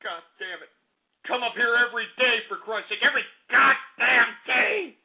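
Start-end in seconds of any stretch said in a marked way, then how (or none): none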